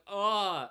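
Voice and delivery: loud, drawn-out "ugh"; exasperated groan. Acted. Streamer reacting to a mispronounced name, loud exasperated groan. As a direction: groan loudly in disgust. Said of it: "I'm like".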